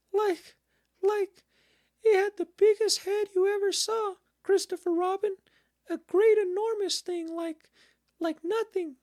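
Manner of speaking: very high, loud squeaky piglet; flustered Piglet. A narrator's reading. Piglet describes the monster to Christopher Robin, flustered high squeal. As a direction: stammer and grope for words in squeaky alarm.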